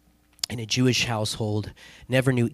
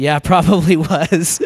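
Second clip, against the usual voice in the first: louder, higher agreement through laughter; laughing reply; 5 semitones higher, 8 dB louder than usual. Retold or imitated. Pastor agreeing with friend that it was devil music, laughing. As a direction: laugh as you retell agreeing. Laughs while retelling his own answer.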